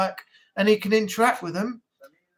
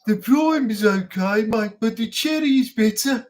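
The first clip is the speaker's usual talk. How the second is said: put-on Birmingham accent. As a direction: sell it without any joy, Brummie style. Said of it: "imagine being from Birmingham".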